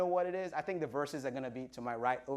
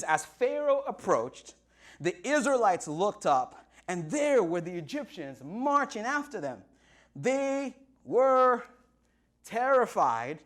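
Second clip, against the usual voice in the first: raised, emphatic preacher's reading; dramatic scripture reading; 4 semitones higher, 4 dB louder, slower than usual. Acted. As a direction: build suspense, landing hard on each phrase.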